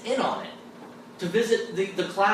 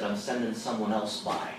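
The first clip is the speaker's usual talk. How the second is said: lightly voiced God, lower and softer; God's reply; 9 semitones lower, 6 dB quieter than usual. God answers the teacher's prayer, understated low reply.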